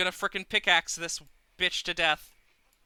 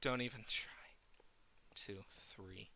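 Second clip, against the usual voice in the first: whisper; 7 semitones lower, 15 dB quieter than usual. Real, not acted. Whispering to golem.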